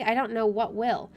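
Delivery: ordinary talk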